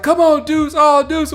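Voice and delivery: high mock crowd groan; mocking disappointed fans. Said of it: "the crowd was like".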